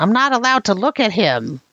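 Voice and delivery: high-pitched goblin reply. Acted. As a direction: squeak it out like a nervous little goblin. GM voicing goblin NPC.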